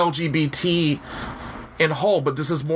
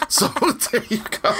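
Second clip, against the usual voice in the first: higher, slow, through a real laugh; 7 semitones higher than usual. Real, not acted. Host wrapping up after a co-host's joke, laughing. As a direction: let laughter spill into the words.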